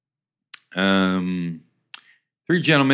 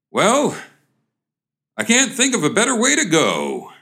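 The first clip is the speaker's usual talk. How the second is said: exclaiming; 11 semitones higher, 3 dB louder than usual. Acted. Lumberjack standing by the fallen tree, exclaiming. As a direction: exclaim it high, with a pause after the first word. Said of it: "one of the gentlemen exclaims".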